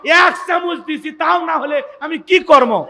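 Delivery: high, loud mock quarrel; wife loudly blaming the shop's turmeric, laughing; mock scolding wife. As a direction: act out the wife snapping back, half laughing. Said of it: "স্বামী যেমন ঝাড়ি মারে".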